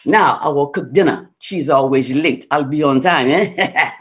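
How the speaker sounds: low, smug boasting husband; husband muttering his plan, low and smug. Acted. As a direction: brag to himself, voice dropped low.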